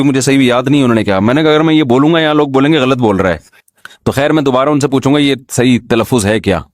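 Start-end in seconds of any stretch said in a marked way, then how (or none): none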